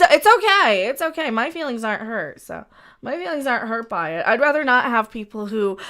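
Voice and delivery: ordinary talk, nothing marked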